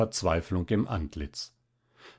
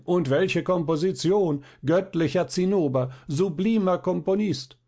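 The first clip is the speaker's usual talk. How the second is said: higher, ringing mock adulation; mock chorus of praise; 7 semitones higher than usual. Violinist mimics everyone calling out together, rapturous chorus. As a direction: imitate a whole crowd echoing the praise. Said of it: "alle einstimmig rufen hinterdrein".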